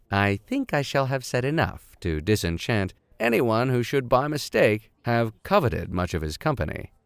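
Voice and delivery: measured storybook reading voice; story narration. A narrator's reading. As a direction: read it like a classic adventure novel. Reads novel aloud as narrator.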